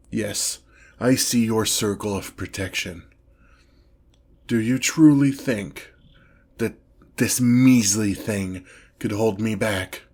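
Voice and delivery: ordinary talk, nothing marked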